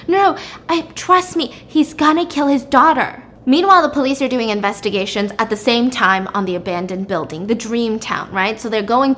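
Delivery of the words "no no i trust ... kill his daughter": urgent pleading. Retold or imitated. Acted wife pleads urgently to husband.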